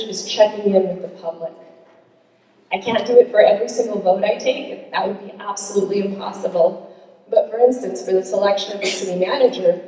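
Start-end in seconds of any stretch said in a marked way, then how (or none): none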